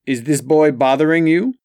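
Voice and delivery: a hint of island lilt, protective; light Jamaican accent, teasing. A narrator's reading. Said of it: "in her island lilt".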